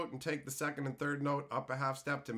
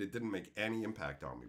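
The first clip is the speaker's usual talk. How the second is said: usual speaking voice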